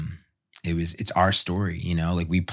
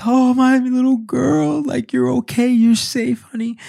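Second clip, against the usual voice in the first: tender soothing; 6 dB louder than usual. Retold or imitated. Father soothing his newborn daughter, high tearful tenderness. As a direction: soothe your newborn through tears. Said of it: "And just like".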